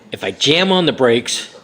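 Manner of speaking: ordinary talk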